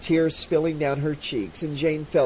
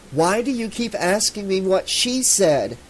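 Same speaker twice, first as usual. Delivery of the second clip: hysterical; 6 semitones higher, 3 dB louder than usual. Acted. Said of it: "Jane felt hysterical".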